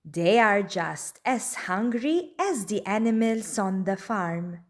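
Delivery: plain reading voice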